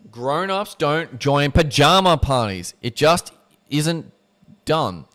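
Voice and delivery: slow, loud, prim pronouncement; prim verdict. Acted. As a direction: Rona lays down the rule to O'Donnell, slow and prim. Rona's prim verdict voiced.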